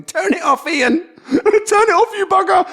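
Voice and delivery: high, loud laughing shriek; laughing shout. Acted. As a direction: shriek it through laughter at the camera.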